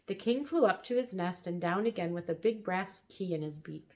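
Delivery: ordinary delivery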